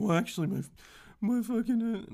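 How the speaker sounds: demonstrating a rude person answering how are you, in a mock whiny voice; rude oversharer. Shown as a demonstration. Imitation of a rude oversharer. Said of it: "like I just demonstrated".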